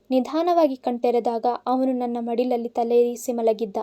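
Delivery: plain reading voice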